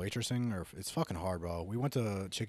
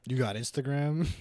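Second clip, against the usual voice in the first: louder, slow, blunt demand; blunt ask; 5 semitones higher, 7 dB louder than usual. Shown as a demonstration. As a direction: bark the question flatly. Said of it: "Don't say it like that".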